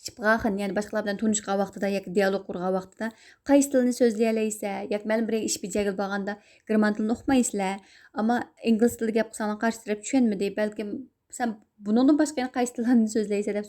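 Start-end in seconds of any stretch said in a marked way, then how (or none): none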